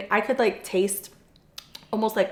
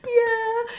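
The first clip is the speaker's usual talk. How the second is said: mock crying wail. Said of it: "I was just boo-hoo crying".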